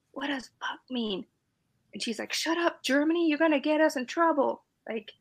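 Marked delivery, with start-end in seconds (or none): 0.1-1.2 whispered secret question. Retold or imitated. Recruit whispering to her friend in line. 2.3-4.6 higher, lightly voiced urgent hush; a hint of a friend hissing back; hushed scolding friend. Friend in boot camp scolding her, urgent hush.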